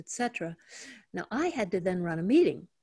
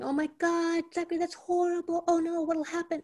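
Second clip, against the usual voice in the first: much higher, rushed mock panic; panicked reaction; 9 semitones higher than usual. Mother hearing bad news from her son, mock panic. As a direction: act out the frantic parent overreacting. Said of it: "instead of reacting and going".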